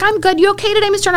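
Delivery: high, gentle caring coworker; sweet, high concerned question; lightly voiced colleague.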